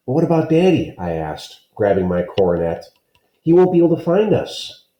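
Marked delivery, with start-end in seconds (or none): 0.1-1.0 put-on higher, anxious child voice; a kid fretting about his dad; lightly voiced worried boy. 3.4-4.9 put-on higher, fretful kid voice; lightly voiced fretful boy; anxious child. Child fears lost father.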